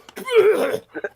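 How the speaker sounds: high retching noise mimicking a baby throwing up; imitated baby vomiting. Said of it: "The baby just goes".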